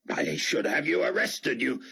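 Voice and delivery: stiff, offended officer barking a threat; lightly voiced indignant commander. Fortunato to Dart, lightly voiced indignant threat.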